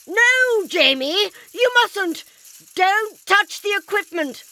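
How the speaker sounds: high, loud urgent yell. Acted. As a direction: shout to stop him in time. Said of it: "the doctor yelled".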